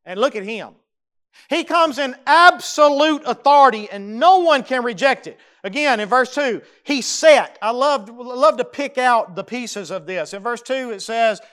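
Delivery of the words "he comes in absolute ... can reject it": loud, high proclamation; full-force sermon climax; preaching at full force. Acted. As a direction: thunder it from the pulpit. Preacher proclaiming angel's authority.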